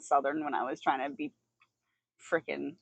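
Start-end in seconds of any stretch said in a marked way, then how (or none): none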